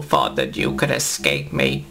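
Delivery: low, menacing villain voice; deep Davros taunt. Acted. Davros mocks the trapped Doctor, deep and sneering.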